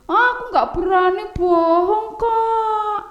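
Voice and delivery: put-on high sing-song child's voice; timid little brother. Oci tells his brother he won't lie, high and sing-song. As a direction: refuse shyly like a small boy.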